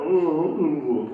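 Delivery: loud yawning ooh; hollow yawn sound. Shown as a demonstration. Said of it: "like you're yawning".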